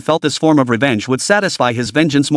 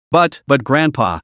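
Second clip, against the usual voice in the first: ordinary delivery, nothing marked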